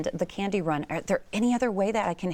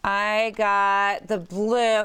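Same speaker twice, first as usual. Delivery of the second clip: ordinary talk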